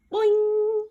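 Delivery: high, loud springy boing; boing effect. A narrator's reading. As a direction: bounce the word up. Bounce sound effect.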